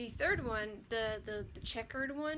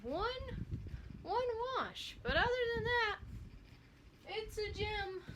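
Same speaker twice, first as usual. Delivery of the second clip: much higher, mock outraged; exasperated squeak; 10 semitones higher than usual. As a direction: squeak it in disbelief. Exasperated high voice.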